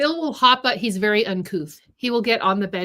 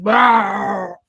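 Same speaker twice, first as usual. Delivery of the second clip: dinosaur voice; 8 dB louder than usual. Pretend. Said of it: "So I could just be like".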